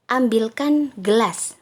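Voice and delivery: loud, slow order with no please; bossy command. Shown as a demonstration. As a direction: give it as a curt command, like you're annoyed. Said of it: "ketika suara kita agak nadanya tinggi".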